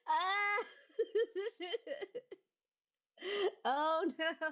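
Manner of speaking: squealing laughter; laughing squeal. Real, not acted. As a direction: laugh in happy disbelief. Laughing cry over confusion.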